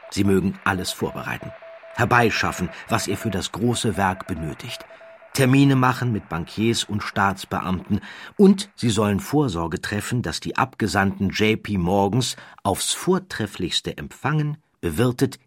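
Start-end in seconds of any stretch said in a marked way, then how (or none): none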